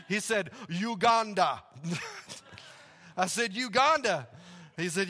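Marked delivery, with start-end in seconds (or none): 0.7-1.6 loud imitation of a Ugandan accent; name the country proudly Ugandan-accented. Stranded man telling the pastor where he's from, Ugandan accent. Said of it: "This is an awesome accent".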